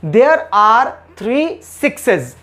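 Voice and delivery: very slow, word by word; slow model sentence. Shown as a demonstration. Teacher modelling the sentence for students, very slowly. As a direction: lay each word down separately. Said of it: "तो हम क्या बोलेंगे?".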